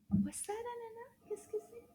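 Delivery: high, soft little questions; light, high coaxing voice; talking to a puppet. Pretend. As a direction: ask a small puppet softly, pitched high.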